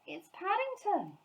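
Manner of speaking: high, slow amazed cry; surprised recognition. A narrator's reading. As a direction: stretch it out in amazement. Mrs Bird recognising him.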